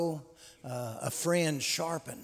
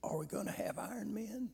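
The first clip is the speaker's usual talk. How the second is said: put-on weak old man voice; 5 semitones higher, 9 dB quieter, faster than usual. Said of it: "he says in a weak voice".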